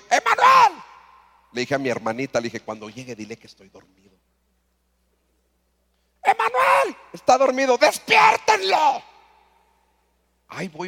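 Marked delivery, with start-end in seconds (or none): 0.1-0.8 grito muy agudo y fuerte del nombre; llámalo a todo pulmón desde abajo; grito llamando. Retold or imitated. Padre llama a gritos al llegar. 2.6-4.2 mucho más bajo, en secreto; díselo en voz baja para que no oiga; susurro. Retold or imitated. Niño susurra a su hermanita. 6.2-9.0 gritos muy agudos y fuertes, el final lento; grito furioso. Retold or imitated. Padre furioso grita en casa.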